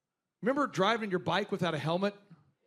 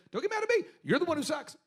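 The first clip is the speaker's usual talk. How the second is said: mocking boss, pitched much higher; 7 semitones higher than usual. Boss taunting the employee he shamed, high mocking. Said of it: "He's like".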